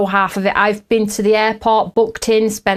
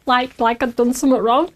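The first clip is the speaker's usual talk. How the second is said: ordinary delivery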